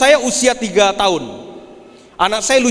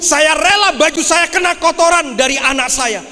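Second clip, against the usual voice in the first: preaching at full force; 8 semitones higher than usual. Acted. Father tells the congregation why he is not angry, shouted preaching. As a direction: proclaim it, almost shouting.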